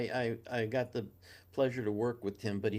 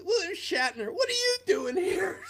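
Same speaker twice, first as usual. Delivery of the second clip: high, shrieking panic at an idol's surprise visit; startled shriek. Retold or imitated. Caught alone in the studio by his idol, startled shriek. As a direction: yelp it out as you fall backwards. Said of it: "I was falling back and go".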